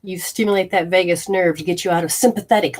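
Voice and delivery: ordinary talk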